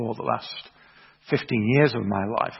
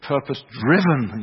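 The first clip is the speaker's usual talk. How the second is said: normal talking voice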